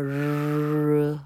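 日 held as a long English "rrr"; R sound demo. Shown as a demonstration. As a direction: sustain 日 as an R, slowly and evenly. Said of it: "R是念作日". (日拉长念成英文R的音)

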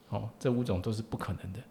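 usual speaking voice

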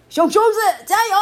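fast, high, loud cheer; rally your kid like a proud papa; cheering dad. Acted. Dad cheers his son off to kindergarten loudly. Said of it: "爸爸说".

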